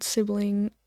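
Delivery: usual speaking voice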